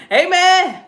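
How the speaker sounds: big joyful shouted amen; loud amen. Real, not acted. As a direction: belt out the amen. Loud amen closing prayer.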